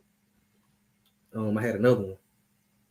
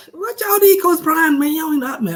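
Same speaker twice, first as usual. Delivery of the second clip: mocking high voice; 14 semitones higher, 5 dB louder than usual. Mocks Coach Prime fans.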